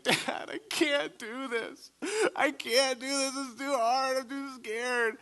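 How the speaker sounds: put-on small boy crying on the wall. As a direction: sob it out like a terrified child. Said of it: "I start to, like, cry".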